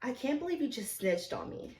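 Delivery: soft, level confrontation; re-enacted quiet reproach. Server confronts coworker who told on her, quietly. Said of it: "this is literally how it goes".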